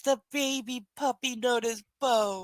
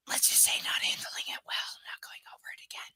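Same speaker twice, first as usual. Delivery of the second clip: whispered aside; 5 dB quieter, faster than usual. Real, not acted. Shying from a dark topic.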